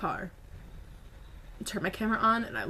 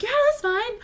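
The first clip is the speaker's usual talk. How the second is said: eager agreement; 14 semitones higher, 5 dB louder than usual. Retold or imitated. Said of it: "everyone's like".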